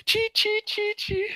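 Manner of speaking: high, chirping kung fu cries; kung fu chirps. Retold or imitated. GM mimics Iron Fist's fight noises, high chirps. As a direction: squeak out little martial arts noises. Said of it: "Iron Fist is like".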